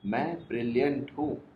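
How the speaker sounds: usual speaking voice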